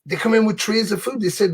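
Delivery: ordinary delivery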